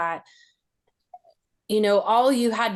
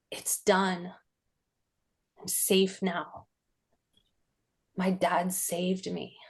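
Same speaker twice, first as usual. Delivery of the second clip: softer, slow, hushed relief; relieved inner thought; 2 semitones lower, 7 dB quieter than usual. Retold or imitated. Teen leaning on the door after her dad left, quiet relief. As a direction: breathe it out like it's finally over.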